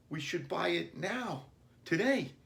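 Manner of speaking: put-on high, urgent child voice; boy fretting that the puppy may go; insistent boy. A narrator's reading. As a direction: insist like a worried kid. Billy insisting to himself.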